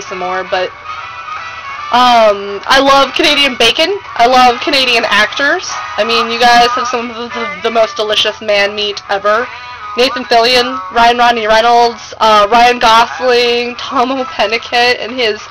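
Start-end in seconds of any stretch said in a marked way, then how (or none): none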